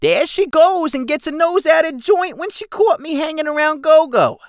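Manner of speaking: high, loud goblin grumble; sulky goblin. Acted. Keswick complains to Jigsaw, high and loud.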